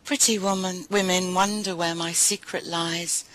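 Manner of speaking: proud, building recital of confident verse; poem recital. Acted. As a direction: own every line with swagger. Recites Maya Angelou poem.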